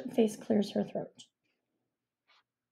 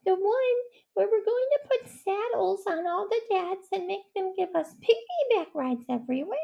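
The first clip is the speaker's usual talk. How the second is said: high badger voice; 11 semitones higher than usual. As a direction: play a timid badger, high and careful. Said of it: "said Stuart the Badger".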